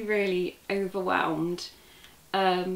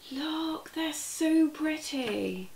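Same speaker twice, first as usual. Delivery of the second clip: soft admiring coo; 7 semitones higher, 4 dB quieter, much slower than usual. Real, not acted. Admiring yarn.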